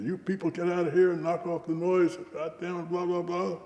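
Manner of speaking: high-pitched mock holler; half-real yell at noisy people; retold hollering. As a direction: holler a complaint, tailing into dismissive filler. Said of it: "So I started hollering".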